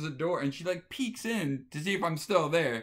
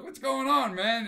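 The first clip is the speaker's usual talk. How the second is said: much higher, louder, overly buddy-buddy; mock-friendly greeting; 11 semitones higher, 5 dB louder than usual. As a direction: play the too-friendly host. Walks at the date in underwear.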